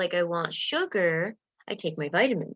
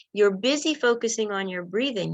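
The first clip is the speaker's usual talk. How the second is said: usual speaking voice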